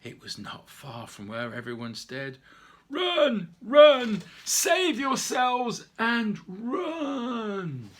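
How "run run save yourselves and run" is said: high, loud cry to flee; panicked shout. Acted. Someone screaming at the animals to flee the fire, panicked. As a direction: yell the warning at everyone.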